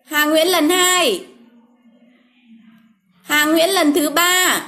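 slow, drawn-out name call; slowly calling a winner's name. Real, not acted. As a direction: spell the name out slowly for viewers.

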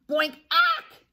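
high, loud "boink" and startled squawk; sudden cartoon bonk, then a sharp yelp. Acorn hits the chick on the head, startled squawk. Collision with Lucky Ducky.

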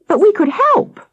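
put-on eager child voice. Child Sam pleading with policeman.

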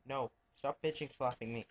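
ordinary talk

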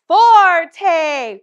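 Forte said loud and high; loud demonstrating Forte. Shown as a demonstration. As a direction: call Forte out boldly. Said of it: "We know to play loud".

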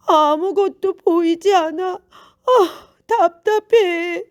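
high, loud exasperated lament; frustrated blind man. A narrator's reading. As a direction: groan loudly at being unable to see. Blind man voices his frustration.

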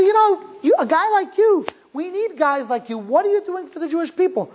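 a hint of an idealistic stranger making his pitch; eager stranger. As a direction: coax him gently but eagerly, voice lifted. Stranger on the bus urging him.

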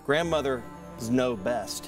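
ordinary talk, nothing marked

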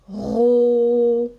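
loud, long-held "Ro"; drawn-out syllable. Shown as a demonstration. Teacher sounding out rose, loud drawn-out "Ro". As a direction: stretch "Ro" out on its own. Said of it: "Alors, on y va".

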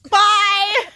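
loud, high laughing bye; laughing shout. Real, not acted. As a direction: call out laughing. Bird flies off.

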